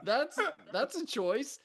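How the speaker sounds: high-pitched, laughing through the words; amused disbelief. Retold or imitated. Co-host reads own notes on the accent, laughing. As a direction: quote it high and slowing, cracking up.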